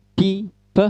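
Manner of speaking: crisp demonstrated p; name the letter pi, then puff a short "p"; teacher sounding out "p". Shown as a demonstration. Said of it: "Cara bacanya biasa".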